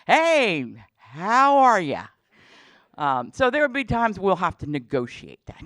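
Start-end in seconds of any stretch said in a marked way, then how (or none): 0.0-2.0 slow, loud, drawn-out hey how are ya; a mock-casual dean dropping by; hammed-up cheery hello, stretched out; breezy dean greeting. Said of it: "come on by and go".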